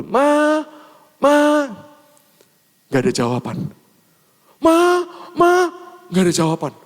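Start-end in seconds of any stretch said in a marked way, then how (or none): 0.0-1.7 high, drawn-out acted call; calling for mother. Lost boy calling his mum at the shop, high acted call. 4.6-5.7 very high, repeated acted call; calling for mother. Lost boy getting no answer from mum, high acted call.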